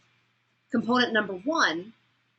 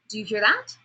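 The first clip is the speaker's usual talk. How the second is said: exaggerated question lift on "that"; questioning upward inflection. Shown as a demonstration. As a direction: pitch swings up on "that". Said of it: "my inflection's going to go up".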